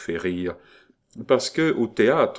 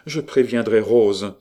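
higher, animated princess protesting; raised, emphatic outburst; exclaimed; 5 semitones higher, 4 dB louder than usual. A narrator's reading. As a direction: exclaim it with lively insistence. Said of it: "s'écria la princesse".

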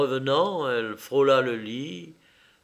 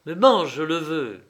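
louder, commanding insistence; insistent order; 3 semitones higher, 6 dB louder than usual. A narrator's reading. Mother orders her son to eat, insistently. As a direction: order it firmly, hitting the verb.

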